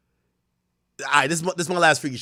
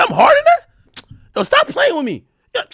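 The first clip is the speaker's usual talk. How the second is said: outraged disbelief. Retold or imitated. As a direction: squeal it in offended shock. Outraged disbelief at own video.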